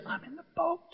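a hint of a sly high whisper; voice of fear. Fear taunts the believer, a light high voice.

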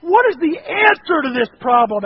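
high, loud desperate pleading; impassioned re-enacted yell, pressing each question; yelling in prayer. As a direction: cry out to God at wit's end. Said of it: "And I start yelling and screaming".

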